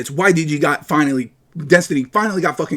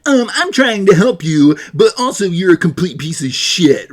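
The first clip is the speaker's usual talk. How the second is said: slow, higher, smug um-actually voice; passive aggressive mockery; 3 semitones higher than usual. Streamer parodies passive aggressive chatters with a slow smug voice. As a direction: drag it out like a sneering know-it-all. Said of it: "their passive aggressive".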